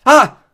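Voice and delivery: sharp startled yelp; startled cry. A narrator's reading. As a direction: jump at the touch. Touching a clammy arm.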